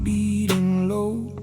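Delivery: normal talking voice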